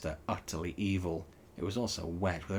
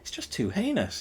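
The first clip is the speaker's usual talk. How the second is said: higher, sharp snap of disbelief; snapped; 7 semitones higher than usual. A narrator's reading. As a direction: snap it out, outraged. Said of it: "he snapped".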